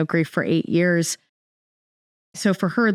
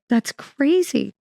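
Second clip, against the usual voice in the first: high, slow, stretched-out amazement; astonished; 8 semitones higher, much slower than usual. Retold or imitated. Reacting to the friend's backyard, slow high amazement. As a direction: drag it out in wonder. Her own retold astonishment.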